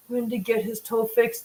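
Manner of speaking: usual speaking voice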